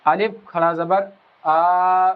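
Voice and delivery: slow, long stretched آ at the end of الف کھڑا زبر آ; slow Quran-class demo of the long vowel; drawn-out recitation. Shown as a demonstration. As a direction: hold the آ in آ twice as long. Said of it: "اس آواز کو جو ہے بڑھا دیں گے".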